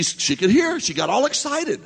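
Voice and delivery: usual speaking voice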